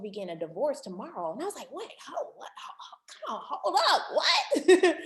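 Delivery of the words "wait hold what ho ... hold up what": retold disbelief. Retold shock at divorce news.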